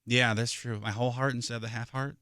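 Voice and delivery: usual speaking voice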